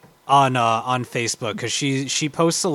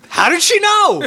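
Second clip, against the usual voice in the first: high, loud astonished cry; amazed squeal; 10 dB louder than usual. Real, not acted. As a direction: squeal in disbelief. Amazed outburst.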